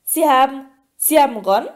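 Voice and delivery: falter, then rise in disbelief; shocked gasp. A narrator's reading. Harry realises merpeople have his friend, shocked gasp. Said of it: "keuchte Harry".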